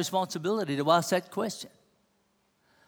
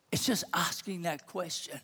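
whisper; 9 dB quieter than usual. Real, not acted. Preacher urges the congregation, in a whisper. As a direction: whisper it to the room.

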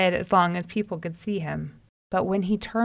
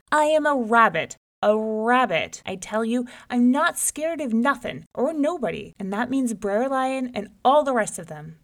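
louder, higher proud boast; 4 semitones higher, 4 dB louder than usual. Acted. Rabbit boasting to the folks with fist raised. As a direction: pound it home proudly.

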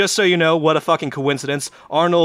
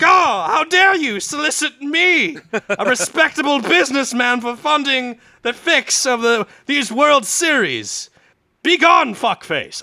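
much higher, louder and slow indignant proclamation; loud mock outrage; 12 semitones higher, 6 dB louder than usual. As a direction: act shocked and offended for the whole room to hear. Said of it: "Arnie very loudly is just like".

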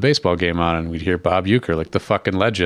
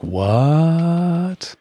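surprised; 8 semitones higher than usual. Retold or imitated. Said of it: "you were like".

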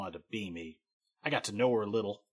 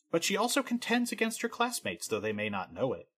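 normal talking voice

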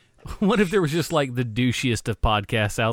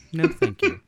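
much higher silly voice; high put-on voice. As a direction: repeat it in a high goofy voice. Repeats refusal in a put-on voice.

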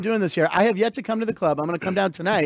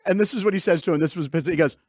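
ordinary talk

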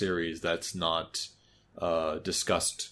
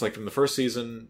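normal talking voice

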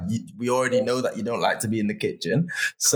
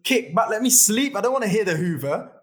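high-pitched, playful pleading; half-joking whiny appeal; exasperated plea; 8 semitones higher than usual. Acted. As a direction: plead for a moment's peace in a raised pitch. Acts out his plea for peace.

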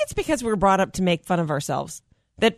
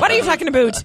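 high Canadian "aboot"; raised, protesting Canadian voice; Canadian accent; 8 semitones higher, 4 dB louder, faster than usual. Acted. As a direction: lean on the Canadian vowel in about. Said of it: "the words would, would come out".

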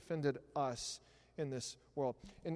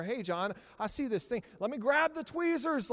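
pushy helper; 7 semitones higher, 6 dB louder than usual. Retold or imitated. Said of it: "I was like".

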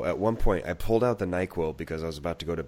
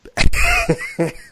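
loud high burst of laughter; big laugh; 10 dB louder than usual. Real, not acted. Host cracks up at his cohost's joke with a loud laugh. Amused reaction to Satan joke.

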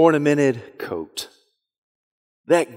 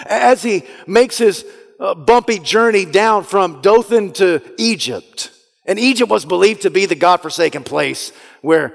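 ordinary talk, nothing marked

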